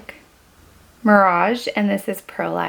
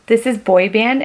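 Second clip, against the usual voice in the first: usual speaking voice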